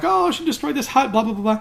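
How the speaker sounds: high, fussy caretaker voice jumping well up in pitch; squawking caretaker complaint. Retold or imitated. Vlogger retelling the caretaker's complaint about Rey, in a high fussy voice. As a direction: play the island caretaker fussing over her ruined hut.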